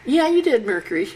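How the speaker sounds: high, loud playful concession; conceding reply. Acted. You answering Mercury the messenger, high-pitched playful concession. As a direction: jump up in pitch, admit it. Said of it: "And you go".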